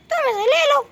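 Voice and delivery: high, playful voice for a cartoon boy; toy's voice. Chhota Bheem toy offers his gun to the kids, in a high playful voice. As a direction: lend the toy a bright little voice.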